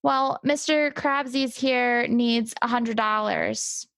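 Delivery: ordinary talk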